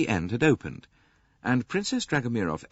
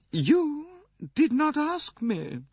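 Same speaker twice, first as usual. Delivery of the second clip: put-on very high, slow, dry old princess; slower than usual. A narrator's reading. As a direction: answer drily as an imperious old lady. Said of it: "said the princess dryly".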